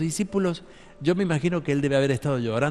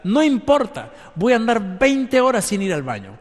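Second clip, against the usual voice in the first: loud, higher, defiant; defiant declaration; 5 semitones higher, 6 dB louder than usual. Acted. Preacher acting a believer who refuses to give up, loud and defiant. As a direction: shout it like nothing can stop you.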